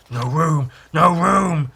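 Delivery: mildly wailed chant of the dead; drawn-out ghostly complaint; corpses' refrain. Acted.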